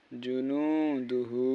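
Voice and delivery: জুনুদুহু said slowly with নু held long; stretched vowel. Shown as a demonstration. Teacher asking students why নু is stretched. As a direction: draw out নু in জুনুদুহু. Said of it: "নু কি জন্য টান হলো".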